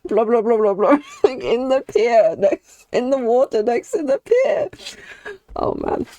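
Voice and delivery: giggling through the words; laughing. Real, not acted. Laughing retelling a D&D moment.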